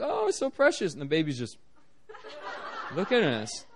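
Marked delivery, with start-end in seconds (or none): 0.0-0.9 put-on gushing over baby. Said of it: "we're like".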